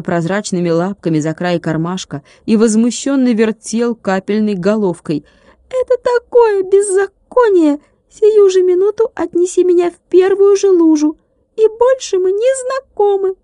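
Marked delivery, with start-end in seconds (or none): 5.7-13.4 high ringing tiny voice, outraged; a little glass man ringing with indignation; indignant ringing. A narrator's reading. Tilka furious at Vladik. (возмущённо звенит, высоким тонким голоском)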